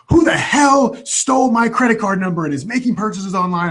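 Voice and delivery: high, strained cry of outrage; retelling a furious, disbelieving yell; mad outburst. Retold or imitated. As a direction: let the anger crack upward. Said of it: "I'm just getting more mad".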